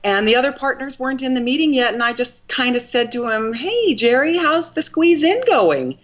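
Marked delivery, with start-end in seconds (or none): 3.5-6.0 high-pitched, casual small-talk opener; lifted, lilting imitation of a friendly inquiry; retold breezy greeting. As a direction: re-enact a chirpy, offhand hello. Retold casual greeting to restaurant owner.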